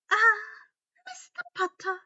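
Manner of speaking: very high, loud sugary greeting; sickly sweet welcome; Umbridge voice. Acted.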